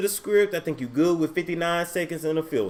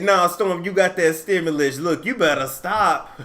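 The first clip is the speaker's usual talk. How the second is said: lightly voiced teasing viewer; teasing warning, half laughing; chat commenter voice; 3 semitones higher, 6 dB louder than usual. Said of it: "somebody in the chat say".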